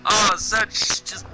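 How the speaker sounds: high, loud, laughing through the words; real delighted laughter. Real, not acted. Streamer delighted by a quip, laughing loud. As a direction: crack up at the joke.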